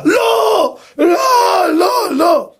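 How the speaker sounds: high, stretched mock cries of no; commander's alarmed cry. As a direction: act out the commander's horrified outburst. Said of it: "המפקד עושה ככה". (זעקת "לא" גבוהה של מפקד)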